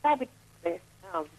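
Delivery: talking away from the mouthpiece; phone held away. Real, not acted. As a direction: voice far from the phone. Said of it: "you gotta talk into the phone".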